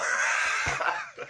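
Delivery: breathy shouted laugh. Real, not acted. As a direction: burst out laughing. Laughing at comment.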